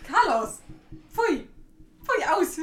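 high-pitched, short dog commands; scolding a dog. Real, not acted. Owner tells Carlos to drop the underwear, scolding. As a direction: tell the dog off.

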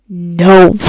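loud, drawn-out burst of refusal; a sudden booming no; emphatic no. Acted. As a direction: blurt out a big appalled NO. Mock outrage at leaving the poop.

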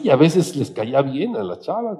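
ordinary talk, nothing marked

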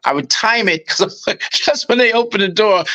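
normal talking voice

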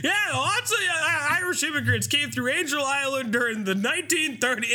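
high, cheery info-dump voice; chirpy townsfolk. Retold or imitated. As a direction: recite trivia like a perky tour guide. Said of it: "they're like".

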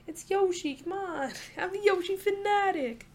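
put-on high, light New York accent. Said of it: "a New York accent right there".

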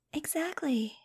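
high, whispery excitement; excited. A narrator's reading. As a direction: breathe it out high and airy, thrilled. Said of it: "Angel said excitedly".

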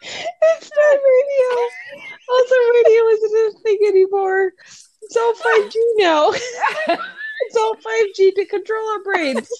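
high-pitched, giggling delivery; giddy laughter. Real, not acted. Co-host joking about bad radio, giddy with laughter. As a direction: talk on through the giggles, pitch way up. Both hosts in fits of laughter.

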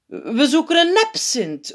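high, slow-stretched call; plots roepend. A narrator's reading. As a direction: call out a sudden idea. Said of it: "roept een andere piet ineens". (ineens uitroepend)

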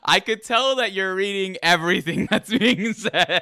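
high laugh through the words. Real, not acted. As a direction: crack up while talking. Laughing at guest reading.

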